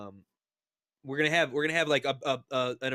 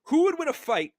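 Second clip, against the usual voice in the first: hyped announcement; 13 semitones higher, 8 dB louder than usual. Acted. Host announces the big question.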